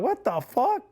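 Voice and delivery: sharp high incredulous outburst; reliving the moment he heard the rumor; retold disbelief. Told his brother is spreading lies, shocked high outburst. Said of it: "I'm like".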